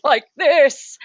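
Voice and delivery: slow, plummy actor voice; Matt Berry impression. Shown as a demonstration. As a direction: drawl it like a pompous actor. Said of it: "me impersonating Matt Berry".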